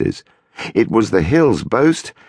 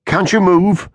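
anxious; 14 semitones higher, 4 dB louder than usual. A narrator's reading. Anxious questioning of wife.

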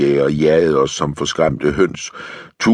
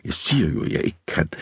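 hushed protest fading to whisper; soft, husky insistence; hoarse whisper; 8 dB quieter, faster than usual. A narrator's reading. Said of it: "hvisker hun hæst".

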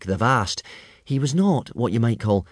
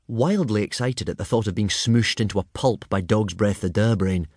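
usual speaking voice

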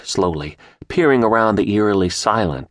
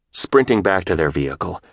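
usual speaking voice

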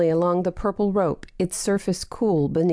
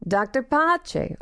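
Doctor Pache slow and higher, rising on Pache; calling out; 7 semitones higher, slower than usual. Acted. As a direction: call her name up the tree. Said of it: "Olsen called again".

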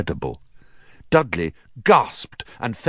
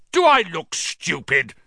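raised, angry snarl; 8 semitones higher than usual. A narrator's reading. Uncle cutting off his nephew at breakfast, snarling. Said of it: "snarled Uncle Vernon".

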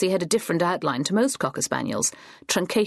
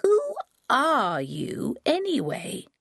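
put-on slow, higher, puzzled old woman; confused old granny; 7 semitones higher, much slower than usual. A narrator's reading. Forgetful Granny asking Thea again, slow and puzzled. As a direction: ask it as if meeting her for the first time.

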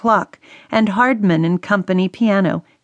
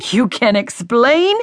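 rising, disbelieving retort; angry father echoing his daughter; incredulous outburst; 4 semitones higher than usual. A narrator's reading. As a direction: throw her words back at her. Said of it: "He exploded again".

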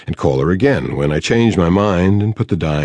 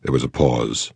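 ordinary talk

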